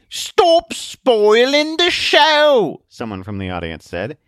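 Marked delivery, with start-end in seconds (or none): 0.1-2.8 very high, loud, drawn-out holler; slow, put-on spectator's yell; annoyed heckler. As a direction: complain from the audience. Audience member heckles.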